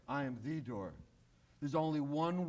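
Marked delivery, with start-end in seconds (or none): 0.1-0.9 "the" stressed, quiet and falling. Shown as a demonstration. Preacher correcting "a door" to "the door", stressing the. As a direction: lean on "the" to mean the only door. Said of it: "Not a door".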